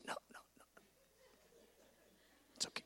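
soft voiceless whisper; imitating voiceless Bob reassuring. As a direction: whisper it calmly like a man with no voice. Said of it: "He goes".

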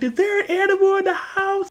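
high-pitched mock questioning; squeaky retold question; alarmed dad quote. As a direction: ask it high, like a suspicious dad. Acting out suspicious allergic dad.

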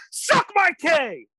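high, slow, strained furious yell; seething angry retort. Retold or imitated. Kid snapping back at his taunter, seething high yell. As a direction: spit it out in seething rage. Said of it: "But he angrily said".

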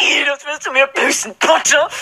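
hoch, heulend drohen; weinerliche Drohung. Acted. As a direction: beleidigt weinend. Draco heulend wütend. (gespielt weinerlich)

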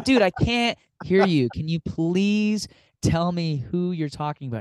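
exasperated, slowed down plea; mock exasperation. Pleading with whispering Danny, mock exasperated. Straining to hear whispered name.

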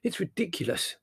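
high, mildly exasperated; understated exasperation. A narrator's reading. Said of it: "began Larry exasperatedly".

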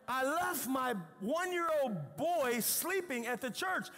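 building from alarm to a high shout; panicked wife. Retold or imitated. Wife realizing they left their baby at church, panicking.

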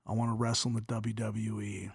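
very low, soft monotone; deadpan little niece. Retold or imitated. Little niece telling uncle her ambition, hushed deadpan. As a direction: say it low and quiet, dead serious.

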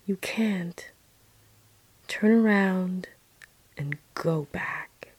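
soft, slow, hushed command; mysterious voice. Retold or imitated. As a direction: speak gently, leaving space between phrases. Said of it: "I heard a voice that said to me".

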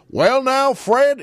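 loud, high blustering senator; Senator Claghorn. Retold or imitated. Imitating a radio senator addressing the host, always loud. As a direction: boom it out like an old radio blowhard. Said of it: "it was always loud like that".

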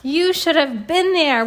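ordinary delivery, nothing marked

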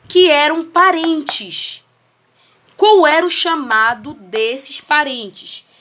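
slow and spaced, with long pauses between chunks. Real, not acted. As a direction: spell it out word by word for a lagging call. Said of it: "eu vou falar bem devagar". (bem devagar, com pausas longas)

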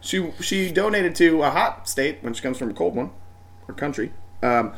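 ordinary talk

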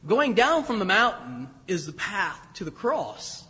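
ordinary talk, nothing marked

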